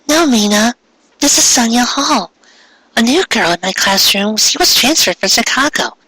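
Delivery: put-on high, polite girl voice; a shy schoolgirl introducing herself; Ami's voice. A narrator's reading. Ami greeting the new girl, high and polite.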